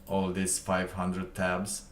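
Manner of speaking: ordinary talk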